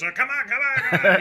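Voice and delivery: put-on high-pitched drunk coaxing; repetitive, whiny-high drunk urging; pestering drunk. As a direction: imitate a tipsy guy badgering for a go. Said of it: "All right, drunk guy".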